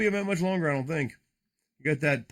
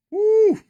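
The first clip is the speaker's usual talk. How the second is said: high, loud woo; excited whoop; 9 dB louder than usual. Real, not acted. Excited card reaction.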